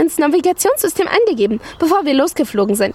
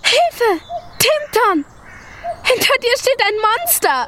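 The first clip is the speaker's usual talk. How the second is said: panicked cry; 10 semitones higher, slower than usual. Acted. Dragonfly sees the boy and panics.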